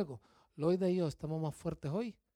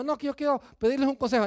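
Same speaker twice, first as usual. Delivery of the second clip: eager churchgoer; 7 semitones higher, 5 dB louder, faster than usual. Retold or imitated. Mimicking a churchgoer wanting advice.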